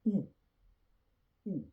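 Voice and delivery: "oo" as a short, pure rounded vowel; French "ou" sound. Shown as a demonstration. Teacher demonstrates the sound of O U, French "ou" sound. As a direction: say "oo" as French "ou", each one separate. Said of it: "that's the sound".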